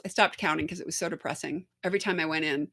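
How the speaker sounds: ordinary delivery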